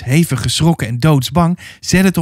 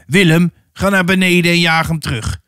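higher-pitched call to a fellow drover; called-out order; 4 semitones higher than usual. A narrator's reading. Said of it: "een van de kerels roepen". (geroepen bevel van een veedrijver)

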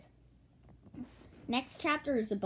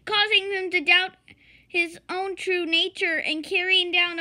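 high, loud, mounting outrage; alarmed story character; 10 semitones higher, 11 dB louder than usual. Acted. Character learns the nanny is in disguise, alarmed. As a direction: build a breathless alarmist speech at raised pitch.

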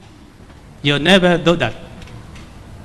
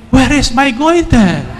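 high, louder retold shout; 6 semitones higher, 4 dB louder than usual. Woman shouting on finding her goiter gone, a mock shout. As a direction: call it out in amazement. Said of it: "So I shouted".

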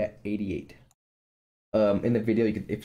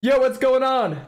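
slow loud yell, much higher; 5 dB louder, slower than usual. Shown as a demonstration. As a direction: shout the greeting to test. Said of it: "But if I get really loud".

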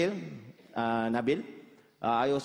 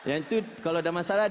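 ordinary delivery

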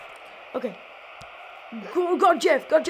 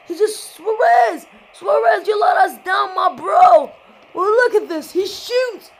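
excited yelling; 5 semitones higher, 9 dB louder than usual. Real, not acted. Gamer scolding Suarez over the match, excited yelling. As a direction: yell at the player who let you down. Reacting to a missed shot.

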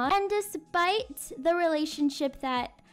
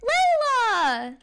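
loud happy call of her name; loud excited welcome; 5 semitones higher, 5 dB louder than usual. Acted. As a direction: shout her name in delight. Little brother greets sister.

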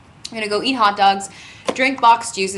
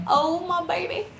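put-on baby talk; 10 semitones higher than usual. Cooing over new guitar.

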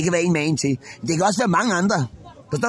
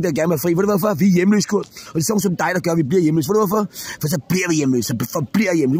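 usual speaking voice

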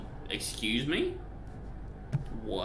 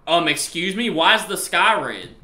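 louder, higher, slow incredulous question; alarmed disbelief; 5 semitones higher, 7 dB louder, slower than usual. Real, not acted. Player seeing a red sky, alarmed disbelief. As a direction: demand an answer, loud and drawn out.

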